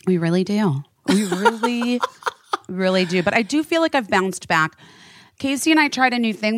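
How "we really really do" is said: laughing through the repeat. Real, not acted. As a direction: giggle the words out.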